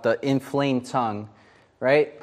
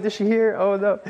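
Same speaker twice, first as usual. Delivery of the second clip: worried panic; 6 semitones higher than usual. Retold or imitated. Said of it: "And I was like".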